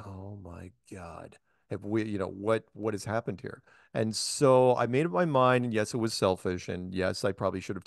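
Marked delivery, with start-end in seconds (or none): 0.1-3.6 very soft, slow, stunned murmur; hushed dread. Retold or imitated. Speaker to himself, realizing the troll goes on first, hushed and stunned. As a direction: sink into quiet disbelief. Dreading going on after him.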